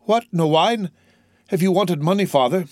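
put-on high, alarmed young son; dismayed. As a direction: press an impoverished father in disbelief. Son dismayed at father's poverty.

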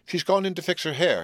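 high, excited announcement; wild announcement. A narrator's reading. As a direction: blurt it out wildly. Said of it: "he announced wildly".

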